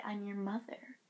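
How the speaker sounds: breathless whisper of a firm mother; whispered mom. Retold or imitated. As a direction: drop to a whisper, mock-stern. Mom insisting on seeing wound.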